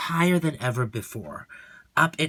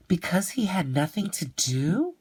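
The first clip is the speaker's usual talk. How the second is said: put-on higher curious child voice; child's guess; 6 semitones higher than usual. Leo guesses why the rich boy is unhappy.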